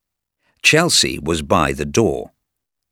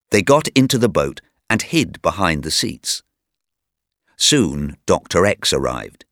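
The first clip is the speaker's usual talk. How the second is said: plain reading voice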